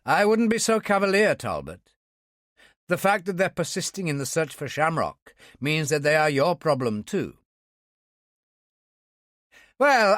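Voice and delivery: lightly voiced dismissive Talbot. A narrator's reading. Speaker warns Talbot.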